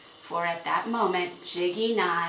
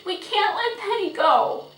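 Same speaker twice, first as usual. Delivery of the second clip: high, loud desperate plea; begging; 8 dB louder than usual. A narrator's reading. Girl begging father not to lose the horse, pleading. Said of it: "she begged".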